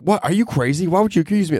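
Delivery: high-pitched, imitated outrage; mock indignant. Accused pickpocket protesting to the accuser, mock indignant. Acts out indignant denial by suspects.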